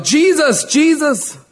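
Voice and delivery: high-pitched cry from the crowd; acted scream. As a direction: call the name out high, like an excited onlooker. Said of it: "somebody screams out".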